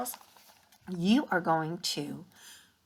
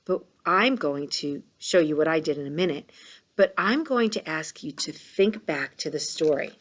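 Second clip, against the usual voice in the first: normal talking voice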